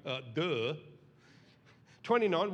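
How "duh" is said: sarcastic duh. Retold or imitated. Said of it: "a word in there from David going".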